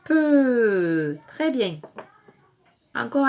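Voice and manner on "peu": stretched "Peu" sliding steadily downward; drawn-out syllable. Shown as a demonstration. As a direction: hold "Peu" on a long falling glide. Model answer in syllable game.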